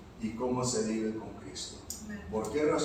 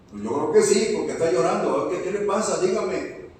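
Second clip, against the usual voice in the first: louder, higher re-enacted urging; insistent concern; 5 semitones higher, 7 dB louder than usual. Pastor urging the crying worker to tell him, insistent. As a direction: press him to say what is wrong. Acting out his insistent questioning.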